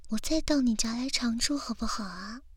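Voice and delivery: ordinary delivery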